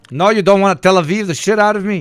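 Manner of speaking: hammed-up loud objection; loud mock protest. Mock outrage at the clip.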